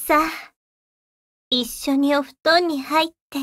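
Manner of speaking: close, hushed devil whispering; ASMR whisper. Acted. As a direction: whisper softly into the ear.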